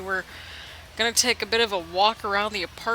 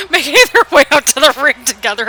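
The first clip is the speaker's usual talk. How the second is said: louder, laughing self-correction, getting it right this time; 4 semitones higher, 10 dB louder than usual. Real, not acted. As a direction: blurt the fixed line out louder. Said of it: "I was trying to say that".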